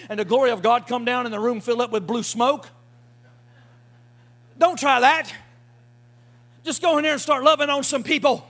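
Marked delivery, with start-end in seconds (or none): none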